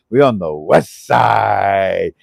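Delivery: slow, drawn-out Hawaiian pidgin. Pretend. Host shows viewers how Hawaiians say it, slow Hawaiian pidgin. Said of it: "Make sure you put that accent to it".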